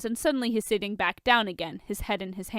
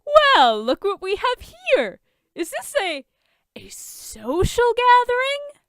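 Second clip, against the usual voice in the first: much higher, mocking sing-song; smug villain; 8 dB louder, slower than usual. A narrator's reading. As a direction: preen over catching them together. Lex's smug entrance.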